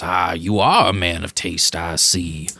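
lightly voiced grand gentleman; a hint of a lordly strongman, low; Danger Bob. GM voicing Bob to the player, a touch grand. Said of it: "he kinda laughs and goes".

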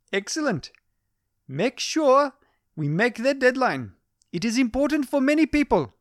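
put-on high, commanding Indian-accented boss. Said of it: "her commanding tone".

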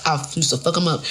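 ordinary delivery

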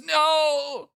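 drawn-out, high mock cry; Skald's dramatic no. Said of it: "Skald says".

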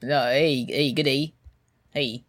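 badly faked Australian greeting; bad Australian accent. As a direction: imitate a poor Australian impression. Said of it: "Colin Mockery's bad Australian impression".

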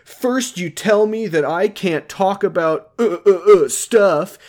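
high, exasperated mock rant; retold yelling. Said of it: "yelling at her in front of everyone".

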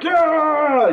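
high, loud cheering yell; crowd cheer. Acted. Friends cheering Pim from the crowd, a loud yell. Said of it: "You hear, like".

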